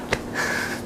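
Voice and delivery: breathless, unvoiced chuckle; soft laugh. Real, not acted. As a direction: laugh quietly under your breath. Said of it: "you're cracking me up".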